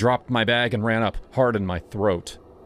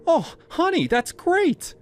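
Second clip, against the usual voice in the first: delighted mom. Acted. Mother relieved, delighted.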